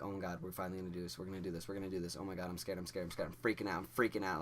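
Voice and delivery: fast, repetitive panicked babble; rapid-fire nervous chant under the breath; panicked inner monologue. Retold or imitated. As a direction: race through it like frantic thoughts. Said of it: "I'm, like, freaking out in my head".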